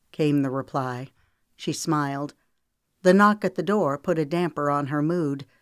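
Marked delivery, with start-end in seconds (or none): none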